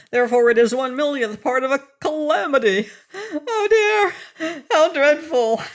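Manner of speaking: high, loud, tearful wail; comic despair building to a cry. Acted. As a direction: mourn a tiny dent like a catastrophe. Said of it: "said Pessum in a wailing voice".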